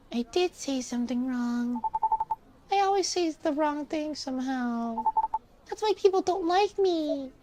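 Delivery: put-on high, downcast nine-year-old; high child voice, sorry for herself; kid voice. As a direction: sulk like a little child. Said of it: "I'm reading in this kid voice".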